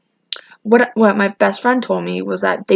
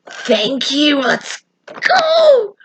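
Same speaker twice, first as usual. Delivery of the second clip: high squeal of retold delight; excited cheer; 7 semitones higher, much slower than usual. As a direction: cheer it, voice shooting way up. Acted thrill at getting Burger King.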